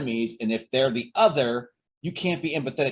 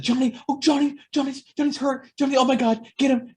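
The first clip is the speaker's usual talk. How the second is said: much higher, louder frantic fussing; mock panic; 12 semitones higher, 4 dB louder than usual. Adults frantic over a hurt child, hammed-up panic. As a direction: play the hysterical grown-up.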